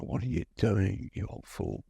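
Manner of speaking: low, soft chiding of oneself; muttered self-reproach. Retold or imitated. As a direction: trail down into a quiet self-scolding. Retold self-talk going towards guns.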